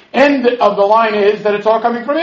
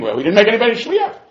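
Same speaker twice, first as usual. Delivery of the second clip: ordinary talk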